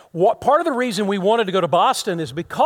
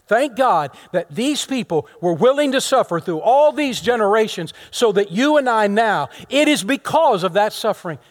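loud, raised sermon voice; emphatic, higher-pitched preaching; impassioned preaching; 6 semitones higher, 6 dB louder than usual. Real, not acted. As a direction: proclaim thanks with pulpit force. Preaches thanks for the martyrs.

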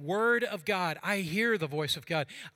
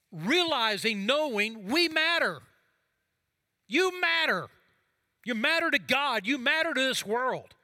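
building from strong to a high ringing cry; rising to a preaching shout; 7 semitones higher, 6 dB louder than usual. Real, not acted. Preacher urging the congregation that they matter, high loud ringing proclamation. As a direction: lift it higher and louder with each you matter, full conviction. Sermon climax, urging listeners.

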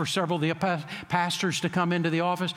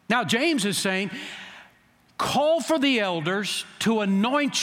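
rising preaching; 4 semitones higher than usual. Acted. Pastor preaching the healing story.

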